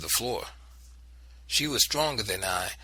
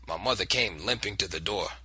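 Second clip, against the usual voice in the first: ordinary delivery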